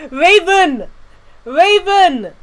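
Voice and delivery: high, loud delighted call of a name; excited shout. Real, not acted. Streamer cheering for a viewer by name.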